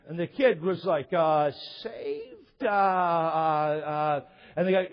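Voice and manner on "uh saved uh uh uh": long, slow, droning hesitation; mock dumbfounded, stuck on drawn-out filler sounds; baffled kid. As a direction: play a kid totally lost for words. Retold kid baffled by question.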